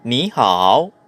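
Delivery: NI lifted as second tone, HAO falling then rising; "ni hao" going up, then down and up; third-tone change. Shown as a demonstration. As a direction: raise "ni", dip "hao". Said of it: "kailangan mo mag-second tone".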